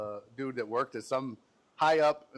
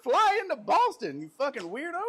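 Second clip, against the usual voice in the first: high, slow, laughing jeer; laughing mockery; 9 semitones higher, slower than usual. Real, not acted. Mocking the Scotland fan who walked, high and laughing. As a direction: laugh it out at the weirdo.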